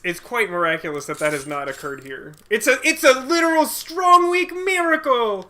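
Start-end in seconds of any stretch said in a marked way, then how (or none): 2.5-5.5 high, loud mock-epic shout; excited exclamation. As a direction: proclaim it like a miracle.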